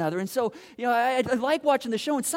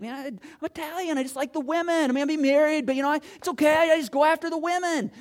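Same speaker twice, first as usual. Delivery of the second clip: lightly voiced Italian guy, pitched higher; mock Italian man; 7 semitones higher, 5 dB louder than usual. Preacher imitating an Italian excusing himself to the congregation, higher mock voice. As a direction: play an unapologetic Italian womanizer, voice lifted. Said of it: "you might be Italian and be like".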